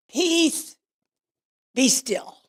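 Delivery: high, loud command that falls away; commanding cry. Retold or imitated. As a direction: call it out to still a raging storm. Jesus commands the storm, retold.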